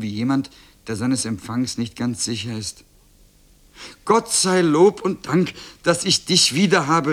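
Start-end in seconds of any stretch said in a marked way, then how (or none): 4.0-7.1 high, loud cry of thanks; elated, high-pitched exclamation; overjoyed call. A narrator's reading. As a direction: call out with a mother's joy and relief. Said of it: "rief die Mutter".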